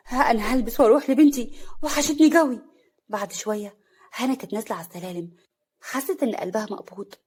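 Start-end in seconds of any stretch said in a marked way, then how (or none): none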